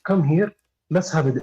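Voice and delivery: ordinary delivery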